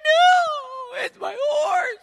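high, loud, tearful wail; little girl sobbing over her horse; crying girl. Acted. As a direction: cry out like a heartbroken child. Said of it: "She's like".

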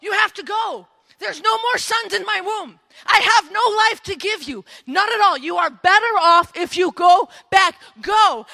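loud, high, insistent plea; emphatic Naomi. Acted. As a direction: send them away forcefully, acting it out. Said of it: "I picture Naomi like".

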